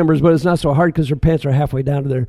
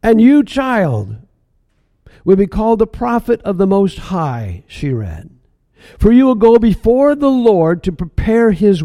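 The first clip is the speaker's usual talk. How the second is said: raised, solemn reading voice; reading scripture aloud; 6 semitones higher, 4 dB louder than usual. Acted. Mary reading the passage aloud to the stranger solemnly. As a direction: read it like a proclamation. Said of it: "finish the passage and read out loud".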